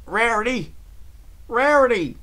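high, loud call for a missing friend; crying out. A narrator's reading. Applejack searching the river for her friend, crying out. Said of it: "she cried out".

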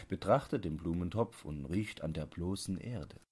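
ordinary delivery, nothing marked

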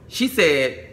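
ordinary talk, nothing marked

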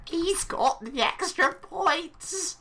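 high, slow triumphant announcement; drawn-out cheer. Real, not acted. Player announcing his extra points, high drawn-out cheer. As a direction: proclaim it high, stretching every word.

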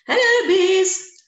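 high, loud, slow sing-song hello; a grand queen greeting her pupils; Queen's greeting. A narrator's reading. Queen bee welcoming her class, high and loud.

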